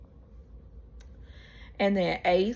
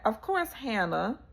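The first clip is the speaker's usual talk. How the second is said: ordinary delivery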